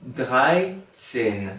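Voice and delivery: dreizehn stretched out slowly, syllable by syllable; careful pronunciation. Shown as a demonstration. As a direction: say dreizehn very slowly, each sound clear. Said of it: "pour dire treize, on dit dreizehn". (dreizehn articulé très lentement)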